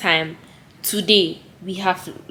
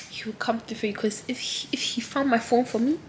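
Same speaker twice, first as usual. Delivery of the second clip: whisper; 9 dB quieter than usual. Real, not acted. Soft heartfelt encouragement to viewers.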